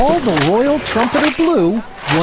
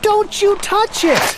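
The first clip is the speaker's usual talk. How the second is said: urgent warning; 12 semitones higher, slower than usual. Acted. Warning continues.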